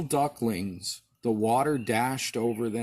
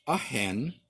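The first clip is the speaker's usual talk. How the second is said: ordinary delivery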